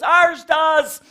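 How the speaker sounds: loud high heckler call-out; heckler shout. Retold or imitated. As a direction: yell it from the front row. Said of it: "a guy down front goes".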